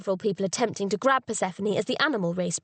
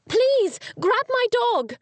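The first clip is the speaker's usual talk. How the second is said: high, loud plea for help; frantic shout; 14 semitones higher, 4 dB louder, much slower than usual. Acted. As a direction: shout to the boys across the room. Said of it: "shouted and waved frantically".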